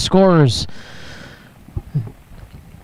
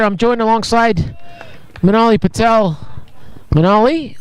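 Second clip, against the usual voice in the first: normal talking voice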